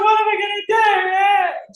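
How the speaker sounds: high, loud panicked shout; mock panic shout. Panicking over bills, high loud shout. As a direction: yell it in mock despair. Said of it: "I was like".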